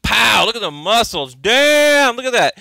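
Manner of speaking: loud, high, thrilled outbursts; hyped-up shouts at a photo of a ripped fighter; excited shouting. Real, not acted. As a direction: yell it like you can't believe the picture.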